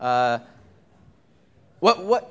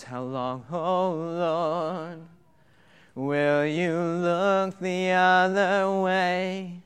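psalm reading; much slower than usual. Acted.

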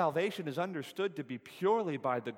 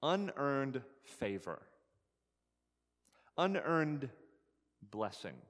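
slow definition; slower than usual. Shown as a demonstration. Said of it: "Grace essentially means".

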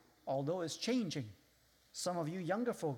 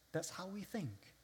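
usual speaking voice

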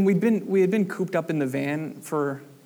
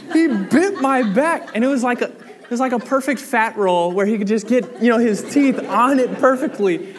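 laughing through the words, high and loud; can't stop laughing while telling it; 8 semitones higher, 7 dB louder than usual. Real, not acted. Preacher laughing as he tells the congregation about the bite.

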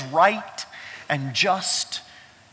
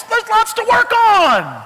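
squeaky comic aside; 9 dB louder, slower than usual. Retold or imitated. Laughing self-deprecation.

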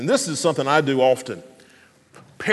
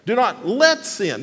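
"let" pitched up and stretched; slow down and lean on let; deliberate emphasis on let; stress on LET; 4 semitones higher than usual. Shown as a demonstration. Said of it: "the next word is the word "let".

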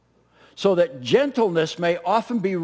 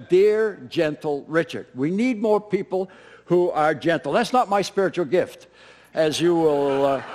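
normal talking voice

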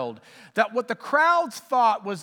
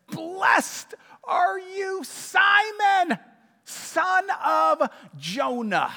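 much higher, slow, joyful cry; excited relief; 8 semitones higher, much slower than usual. Acted. Jesus overjoyed that Peter finally understands, calling out. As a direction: burst out with delighted relief. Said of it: "the excitement and the relief".

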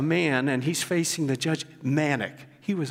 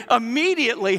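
Immediately called out high and louder; Immediately flung out like a pulpit cry; emphatic; 7 semitones higher, 5 dB louder than usual. A narrator's reading. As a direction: launch Immediately with a loud high push. Retelling the same verse with force.

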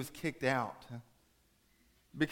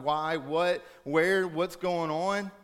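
higher, louder pleading questions; raised, bewildered pleading; anguished questioning; 6 semitones higher, 7 dB louder, much slower than usual. Acted. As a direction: cry out to God for answers. Parent anguished, asking God why.

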